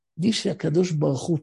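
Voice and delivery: ordinary talk, nothing marked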